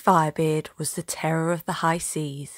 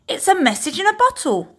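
a much higher, eager shout; excited cry; 12 semitones higher, 4 dB louder than usual. Acted. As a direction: cry out, thrilled at the find. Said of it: "they all cried".

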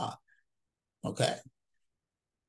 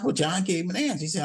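normal talking voice

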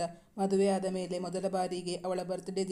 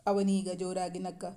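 ordinary delivery

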